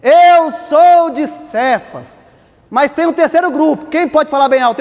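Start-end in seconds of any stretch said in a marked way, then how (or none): 0.0-2.2 slow, louder and higher, drawn out; proud church member. Retold or imitated. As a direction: boast it slowly, chest out. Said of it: "alguém foi dizer".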